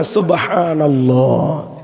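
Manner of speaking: long-held vowels in সুবহানাল্লাহ; correct, beautiful recitation. Shown as a demonstration. Preacher teaching God's name said properly, slow and drawn out. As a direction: draw সুবহানাল্লাহ out slowly and fully. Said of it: "সহি শুদ্ধ করে সুন্দর করে".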